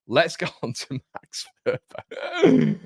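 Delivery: cracking up mid-sentence while trying to carry on; laughing through it. Real, not acted. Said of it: "Thank you, guys".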